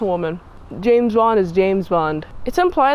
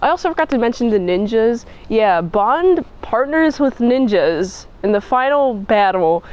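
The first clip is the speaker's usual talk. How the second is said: usual speaking voice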